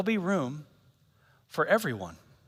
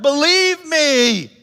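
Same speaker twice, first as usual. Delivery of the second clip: drawn-out, high, loud plea; pleading Jesus; 10 semitones higher, 8 dB louder, much slower than usual. Retold or imitated. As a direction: stretch out "Believe me" slowly, begging. Jesus urging belief.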